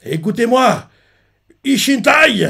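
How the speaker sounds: high, loud call to the army; commanding call. Retold or imitated. Shin addressing his army from the hill, loud. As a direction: call the whole army to listen. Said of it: "il fait".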